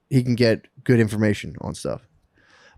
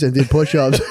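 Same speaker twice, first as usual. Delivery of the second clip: usual speaking voice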